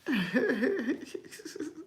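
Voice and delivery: high-pitched giggle; real giggle. Real, not acted. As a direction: giggle at your own impression. Amused by own imitation.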